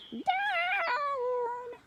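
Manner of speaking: high shouted down; witch's cry. A narrator's reading. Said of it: "cried the witch".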